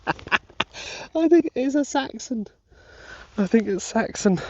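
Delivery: bubbling over with happiness; giddy laughing joy. Real, not acted. Detectorist realises it is Saxon, giddy and high.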